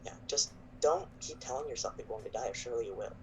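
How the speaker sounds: softly acted, half-whispered counsel; hushed advice. Hannah quietly advising the paladin, lightly hushed. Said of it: "It kind of, like, whispers to him".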